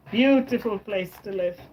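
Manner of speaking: ordinary delivery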